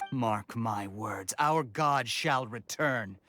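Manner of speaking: very slow, grave pronouncement; ominous. Acted. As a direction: weigh every word like a prophecy. Ominous prophecy to strangers.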